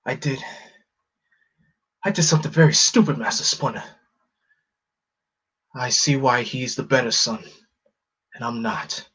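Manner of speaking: lightly voiced remorseful turtle; a hint of guilt, confessing to his master; sad Raph voice. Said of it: "voice of Raph".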